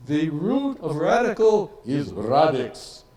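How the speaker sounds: put-on an elderly scholar's German accent, pressing the point on rooted. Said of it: "he said in his very German accent".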